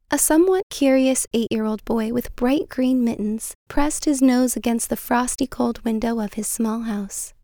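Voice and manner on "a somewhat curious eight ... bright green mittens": usual speaking voice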